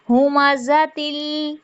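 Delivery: हुमा जा with a hissing ज, tongue behind teeth; whistled z. Shown as a demonstration. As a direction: let the ज of जा whistle. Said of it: "सीटी आ जाएगी". (जा में सीटी के साथ)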